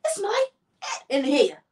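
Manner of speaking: high, loud mock wail of disgust; hammed-up imitation of an overdramatic husband; dramatic disgust. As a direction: cry out as if appalled by a stink. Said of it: "He's very dramatic".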